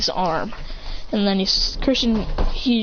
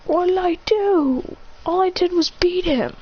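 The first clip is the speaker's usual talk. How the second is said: hurt confusion; 8 semitones higher than usual. Acted. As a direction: plead innocence. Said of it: "And he goes".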